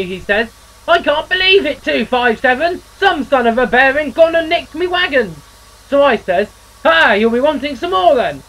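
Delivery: a chirpy railwayman spinning a yarn; engine character voice. Acted.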